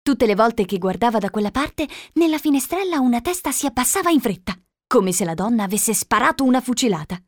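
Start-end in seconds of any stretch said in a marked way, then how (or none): none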